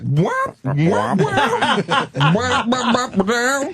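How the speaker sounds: mock cheesy music. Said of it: "That music".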